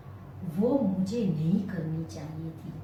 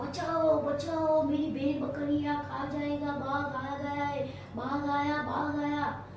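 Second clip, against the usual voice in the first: higher, mildly voiced desperate calls; cry for help; 6 semitones higher than usual. Gopi calls the village as the tiger attacks, mild cry for help. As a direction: call out for help in real fright. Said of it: "इतना जोर से चिल्लाया".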